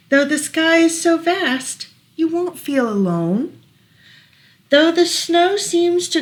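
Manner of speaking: lilting rhyming read-aloud; reading to children. Acted. Parent reading a rhyming book to child, gentle lilt. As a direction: read the rhyme gently to a toddler.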